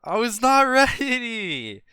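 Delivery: high, loud, drawn-out protest through laughter; stretched-out wail breaking into a laugh. Real, not acted. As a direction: protest loudly while cracking up. Laughing after the scare.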